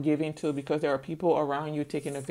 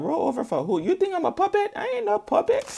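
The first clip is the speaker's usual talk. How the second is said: rising, higher indignant voice; indignant lion; 14 semitones higher, faster than usual. Retold or imitated. Lion insulted by the order, higher indignant voice. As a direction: scoff at the idea, pitched up. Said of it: "talking about".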